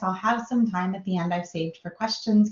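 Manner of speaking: ordinary delivery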